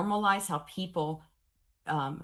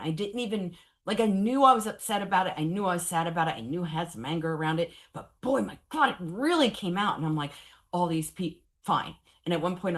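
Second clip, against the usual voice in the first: ordinary talk, nothing marked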